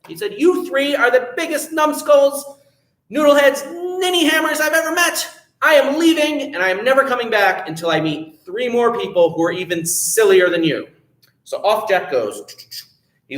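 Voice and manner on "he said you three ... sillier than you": higher, indignant telling-off; storybook hero losing patience with his family; upset scolding. Acted. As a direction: scold them like a fed-up son. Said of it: "got very upset at this".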